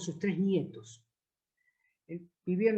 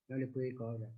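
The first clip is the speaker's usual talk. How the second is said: much softer and lower, touched; quietly moved; 6 semitones lower, 10 dB quieter than usual. Retold or imitated. Taxi driver recalls grandfather he couldn't charge, softly moved. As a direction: let it fall away, moved by the memory. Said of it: "me emocionó tanto".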